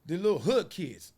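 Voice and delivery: normal talking voice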